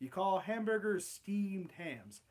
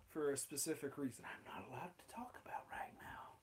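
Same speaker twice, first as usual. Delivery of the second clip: whisper; 4 semitones lower, 13 dB quieter than usual. Real, not acted. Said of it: "secret aside".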